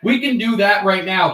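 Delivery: ordinary talk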